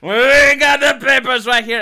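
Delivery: high, very loud, drawn-out crowing; mock triumphant shout. Host imitating influencers showing off the papers, mock shouting. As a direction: bellow it as a hammed-up boast. Mocking right-wing influencers' boasting.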